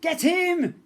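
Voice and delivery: very high, loud commanding shout; ordered. A narrator's reading. Pirate girl ordering her crew at the prince, loud order. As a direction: bark the order. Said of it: "Tempestra ordered".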